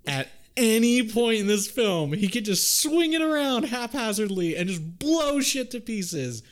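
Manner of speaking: rising exasperated outburst; excited rant. Real, not acted. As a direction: voice jumps high in disbelief. Excited rant about the sword.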